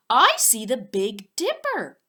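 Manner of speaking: excited discovery; excited exclaim. Acted. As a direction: call it out with delight. Excited Hudson pointing at stars.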